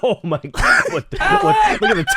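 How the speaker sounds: laughing. Real, not acted. Laughing at a ridiculous reply.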